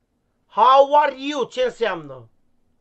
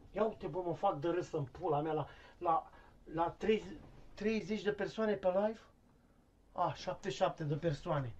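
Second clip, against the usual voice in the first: muttered aside; 4 semitones lower, 14 dB quieter than usual. Acted. Aside about live viewers.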